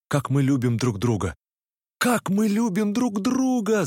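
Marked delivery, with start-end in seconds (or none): 2.0-3.9 high, slow, ringing cry; exclaimed. A narrator's reading. Narrator echoing Nastenka, a high slow cry. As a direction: throw the words out in a slow cry, voice pitched high. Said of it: "закричал я".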